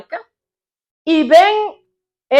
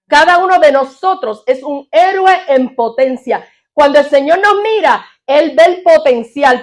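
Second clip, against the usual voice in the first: fervent preaching; 2 semitones higher, 5 dB louder than usual. Acted. Pastor exhorting his church, preaching at full force. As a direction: drive the point home to the congregation.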